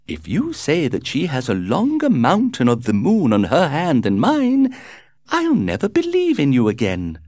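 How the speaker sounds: high, chirpy, breezy society lady; gushing society hostess. A narrator's reading. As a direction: flutter through it like a gossiping hostess. Lady Windermere speaks.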